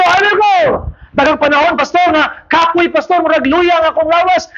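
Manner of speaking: high, ringing sermon build; rally them to keep going; preaching. Acted. Preaching at full force.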